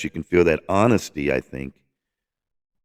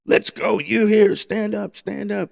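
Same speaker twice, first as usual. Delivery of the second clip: high-pitched rallying call to a crowd; 13 semitones higher than usual. Retold or imitated. Singer tells the concert audience to stand, high imitated call-out. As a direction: imitate a performer urging everyone up from the stage. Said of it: "me and he says".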